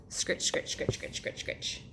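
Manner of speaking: whispered scrubbing sounds. Acted. Narrator making soft toothbrush sounds, whispered. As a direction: hiss the brushing noise.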